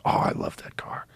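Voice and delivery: soft whisper of longing; whispered fondness. Real, not acted. Remembering his beloved Supra, whispering fondly. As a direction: breathe it out like a confession of love. Fond of his old car.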